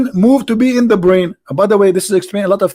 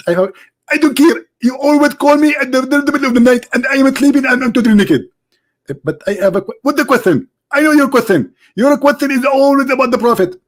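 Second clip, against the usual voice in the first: much higher, indignant preacher voice; Zakir Naik impression; 8 semitones higher than usual. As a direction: play the annoyed preacher being called. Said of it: "Doctor Zakir Naik".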